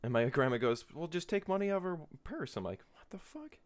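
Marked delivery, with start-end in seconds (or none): 0.9-2.6 lightly voiced grandma. 2.9-3.6 high, breathy disbelief; a high hushed what; hushed shock. Retold or imitated. Shocked reaction to grandma.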